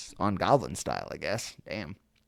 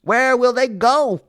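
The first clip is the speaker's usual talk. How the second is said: high, loud worried question; pleading question; 13 dB louder than usual. Acted. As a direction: ask it high and urgent. Once-ler asks the Lorax.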